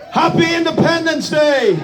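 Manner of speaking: a joyful rallying cry; rousing shout. Real, not acted. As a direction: call it out to lift the whole room. Greeting the crowd at ceremony.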